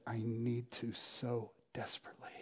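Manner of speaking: a desperate whisper; whispered plea. Retold or imitated. The young man asking the pastor to let him seek forgiveness, desperate whisper. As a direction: barely breathe the words, pleading.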